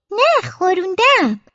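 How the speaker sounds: a hint of whiny excuse; sheepish child. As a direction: slowly protest like a child caught out. Child answers scolding mother.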